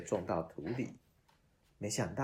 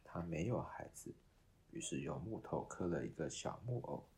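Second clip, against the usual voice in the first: ordinary delivery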